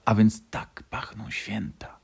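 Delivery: slow, whispery musing; drowsy thought. A narrator's reading. Little fox thinking as he falls asleep, whispered dreamily. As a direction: breathe it slowly, as if drifting off. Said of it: "pomyślał, zamykając oczy".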